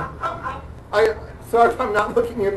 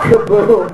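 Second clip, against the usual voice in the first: mock explosion; 6 dB louder than usual. Punchline explosion sound effect.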